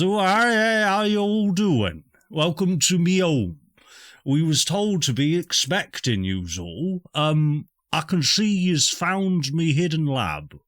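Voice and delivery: high-pitched, drawn-out rustic greeting; farmer wannabe lumberjack. Acted. Game master as farmer welcoming the party, high rustic voice. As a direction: play a skinny would-be lumberjack, pitched well up. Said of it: "a farmer wannabe lumberjack".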